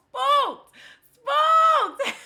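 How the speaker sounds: high, loud laughing holler; gleeful shout. Real, not acted. Storyteller hollering her punchline about the spooked guys, gleeful shout.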